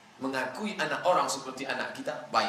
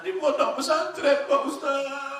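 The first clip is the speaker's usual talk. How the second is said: much higher pitched, put-on woman's voice; mother's voice; 13 semitones higher than usual. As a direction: answer the teacher as a timid mother. Mother answers the preacher.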